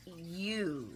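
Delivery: soft, slow, pointed Tracy voice; a hushed, knowing warning; imitating Tracy. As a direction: lean in quietly and point the finger. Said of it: "Tracy's like".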